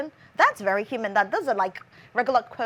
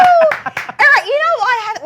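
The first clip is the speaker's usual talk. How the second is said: louder, higher whoop of excitement; excited cheer; 14 dB louder than usual. Real, not acted. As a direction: cheer like a fan. Cheering after the chant.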